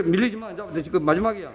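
ordinary talk

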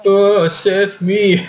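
drawn-out, high, teasing cry for help; mock the scared friend with a slow, high whine; mocking plea. Girl teasing scared classmate with a slow mock plea. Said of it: "Dia malah ngeledekin".

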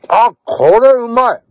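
high, slow, savoring rave; delighted first bite. Real, not acted. Alone in the car, savoring his first bite, high and slow. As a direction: let the taste hit you, drawn out.

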